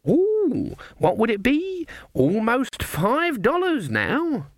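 put-on very high, slow old-lady cooing; naive wonder. A narrator's reading. Granny tots up winnings before sharpers, wonderstruck. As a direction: count it up in high amazement.

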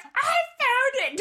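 very high, drawn-out squeal; hammed-up, high-pitched imitation of an excited friend; triumphant announcement. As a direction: crow the find through laughter.

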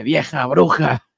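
imitazione di una madre furiosa; slow, drawn-out mock insult; arrabbiata. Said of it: "se enoja y le dice".

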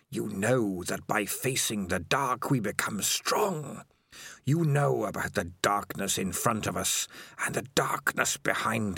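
slow, ghostly whisper from the dark; a creeping, hissing whisper of a lurking monster; eerie spider voice. Acted. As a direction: breathe it like a spider in his head. Spider speaks to Dangerous Beans.

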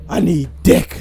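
low, loud put-on voice; voiced big woman. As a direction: play a hulking woman, deep and forceful. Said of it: "would she be like".

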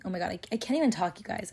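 fast, tumbling words; real excited rush, fast; talking too fast. Real, not acted. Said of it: "I'm also talking so fast".

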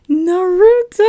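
loud, high, drawn-out hype shout; playful announcer reveal. Pretend. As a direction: stretch it out like a mock arena announcer. Big mock announcer reveal of anime.

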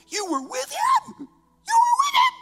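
put-on high shrieking girl voice. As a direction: squeal it like a scandalized girl. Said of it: "That's my girl voice".

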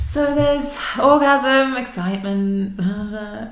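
exasperated. Retold or imitated. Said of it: "You were just sounding so exasperated".

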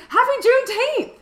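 high, bright mock greeting; imitating an overly cheery coworker, pitched high. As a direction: chirp it way up like a clueless coworker. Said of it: "he goes".

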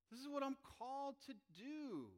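high, loud and impassioned; Paul declaring his calling to the crowd, passionately; Paul's fervent vow. Retold or imitated. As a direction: proclaim it with full fervor. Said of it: "I'll paraphrase here. He goes".

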